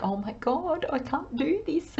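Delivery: soft, high, laughing mock panic. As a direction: play a light wobble of panic, tipping into laughter. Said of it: "exhilarated and excited and the next minute".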